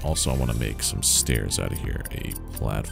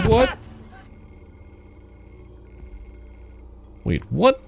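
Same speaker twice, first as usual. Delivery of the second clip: surprised. Real, not acted. Surprised by Twitch follow alert.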